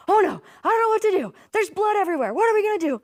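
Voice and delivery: hammed-up freaking-out rescuer; panicked medic. Pretend EMS team at a car crash, hammed-up panic. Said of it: "you don't want those people to be the ones freaking out".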